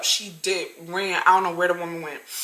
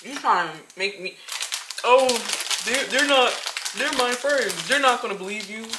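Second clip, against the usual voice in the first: mocking the customer; 2 semitones higher than usual.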